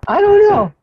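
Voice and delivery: high, loud panicked answer; panicked yell. Real, not acted. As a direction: blurt it out in panic. Panicked under fire.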